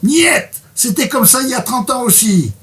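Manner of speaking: high-pitched, emphatic protest; Andy's stubborn refusal. Acted. Reader voices patient refusing hip repair, high stubborn protest. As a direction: refuse the doctor with a leap in pitch. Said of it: "alors ça, c'est Andy qui parle".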